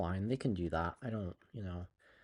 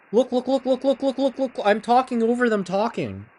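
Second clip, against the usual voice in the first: excited; 8 semitones higher, 5 dB louder than usual. Real, not acted. Excited about radio voices.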